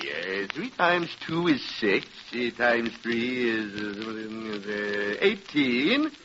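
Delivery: slow, low mumbled arithmetic; hesitant, softened counting with drawn-out pauses; reckoning aloud. Acted. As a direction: work out the sum haltingly under your breath. Shopkeeper works out bill aloud.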